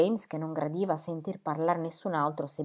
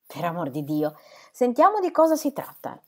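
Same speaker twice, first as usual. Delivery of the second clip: exasperated exclamation; 8 dB louder than usual. A narrator's reading. Sir James to the others, demanding the news, exasperated exclamation. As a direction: exclaim impatiently, pitch leaping up. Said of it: "esclamò Sir James".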